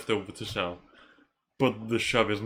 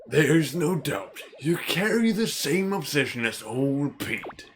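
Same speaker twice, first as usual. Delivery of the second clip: put-on old man voice; old mentor; 3 semitones higher than usual. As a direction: play the knowing village elder. Reads old man's dialogue.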